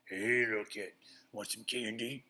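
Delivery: put-on voice of a van creep, loud opening; oddly voiced stranger luring a kid; strange creep voice. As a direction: call out like a cartoon creep. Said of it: "in this strange voice".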